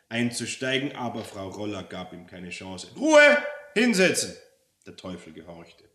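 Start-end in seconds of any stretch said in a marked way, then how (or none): 3.0-4.4 high, loud order; fierce bossy yell; commanding shout. Acted. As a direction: shut him up instantly. Cuts off devil, orders him down.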